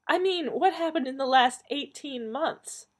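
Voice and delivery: high-pitched, impatient snap; snapping back. A narrator's reading. Donna pressing her mother about lost months, high impatient snap. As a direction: snap back, demanding a real answer. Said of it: "Donna snapped back".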